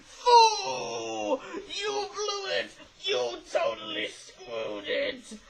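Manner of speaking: high put-on villain voice, slowing as it scolds; hammy villain sneering at a blunder; Snatcher voice. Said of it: "my terrible expression of the, of the Snatcher".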